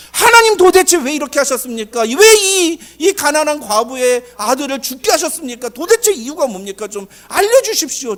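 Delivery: high, loud pleading protest; anguished prayer. Acted. Elijah begs God to explain the widow's loss, anguished loud prayer. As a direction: cry out to God, demanding a reason. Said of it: "그 엘리야도 그렇게 기도한다고요".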